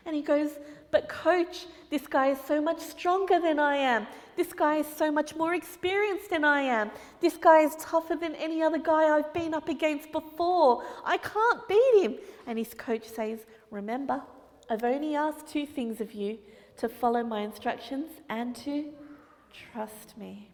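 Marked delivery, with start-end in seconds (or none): 0.9-12.2 high, rising panic, slowing at the end; a scared kid begging out; panicking boy. Acted. Boy panics to coach before the final round, high and fearful. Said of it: "he's really nervous". 13.7-20.5 soft calm coach. Acted. Coach calms the boy.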